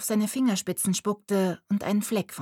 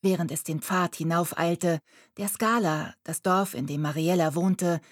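plain reading voice

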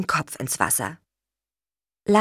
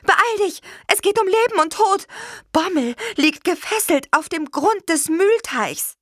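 very high, pressing cry for help; urgent calling. A narrator's reading. Witch urging her beetle to the rescue, urgent calling. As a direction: call out as if lives depend on it. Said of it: "rief sie".

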